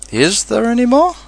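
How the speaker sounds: high, eager rising question; quick, eager question. A narrator's reading. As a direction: jump in with a hopeful question. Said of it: "asked Pooh quickly".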